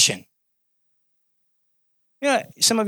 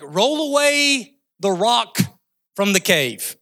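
commanding call; 3 semitones higher, slower than usual. Acted. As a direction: call out the order with stretched vowels. Acts out Jesus's command at tomb.

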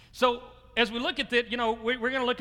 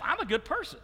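higher, mock self-satisfied, laughing at himself; smug self-talk; 7 semitones higher than usual. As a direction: play the smug good person, half joking. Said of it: "you think to yourself".

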